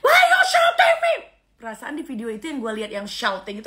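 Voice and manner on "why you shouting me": high shrill-free yelling imitation, much higher and louder; mock foreign shout. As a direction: yell it like the foreigner in the video. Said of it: "yang miss, tapi tidak pernah mungkin orang". (teriakan tiruan yang dibuat-buat, jauh lebih tinggi dan keras)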